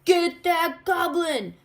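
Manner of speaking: high, loud angry crowd shout. A narrator's reading. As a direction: yell it as the angry mob. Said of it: "shouted everyone".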